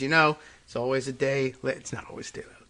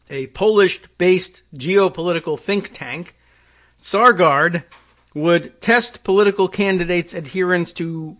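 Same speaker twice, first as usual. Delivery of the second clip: louder, emphatic reading voice; article read-aloud; 5 semitones higher, 8 dB louder than usual. Real, not acted. Host reading a report to listeners, emphatic read-aloud.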